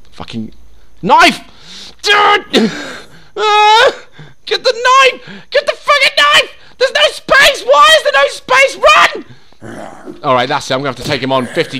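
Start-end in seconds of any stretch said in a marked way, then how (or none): 1.0-10.2 very high, loud frantic shriek; panicked screaming. Real, not acted. Player with no space for the knife, screaming. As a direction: scream it as if being mauled. Screaming as inventory fails mid-attack.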